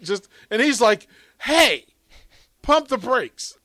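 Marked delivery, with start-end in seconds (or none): 1.4-3.6 raised, higher imitation of an exasperated colleague; mock protest. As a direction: call a halt, imitating a colleague, louder and higher. Voices overwhelmed colleague telling him to slow down.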